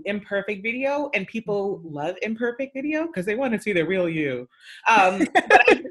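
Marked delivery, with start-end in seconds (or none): 4.9-5.8 anchor voice. Real, not acted. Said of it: "With your anchor voice".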